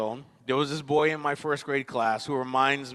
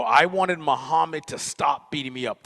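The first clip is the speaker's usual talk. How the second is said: normal talking voice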